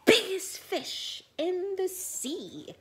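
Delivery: very high, loud eureka shout; triumphant shout of a brilliant idea; sudden idea. Acted. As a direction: burst out with the discovery. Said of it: "suddenly he said".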